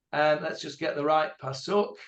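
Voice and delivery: normal talking voice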